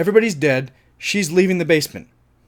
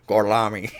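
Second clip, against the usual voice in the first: Gorlami with a thick Tennessee twang; Tennessee accent; 6 semitones lower than usual. Retold or imitated. Audience hearing Aldo's fake Italian, Tennessee accent. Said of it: "Tennessee accent is so fucking thick".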